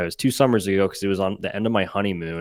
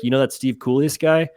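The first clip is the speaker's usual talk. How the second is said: higher, louder feigned-innocent question; retold coy question, pitched up; playing coy; 6 semitones higher, 5 dB louder than usual. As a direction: imitate someone coyly fishing for recognition. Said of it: "he was playing super coy".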